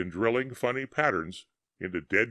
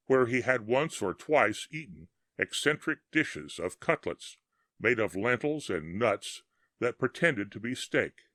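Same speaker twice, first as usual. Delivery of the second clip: usual speaking voice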